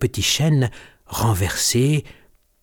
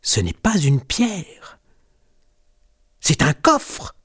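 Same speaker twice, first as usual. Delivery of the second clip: higher-pitched storybook cry of discovery; excited exclamation; 4 semitones higher, slower than usual. A narrator's reading. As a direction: draw it out, rising with surprise. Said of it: "s'écrie Griffounette".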